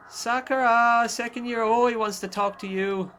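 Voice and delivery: high, drawn-out name call; calling out to a schoolmate. Acted. Reading a character calling out.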